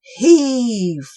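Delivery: loud heaving grunt of a word; effort cry. Acted. Cobwobblies straining to drag the snail. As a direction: heave with all your might. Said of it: "they cried".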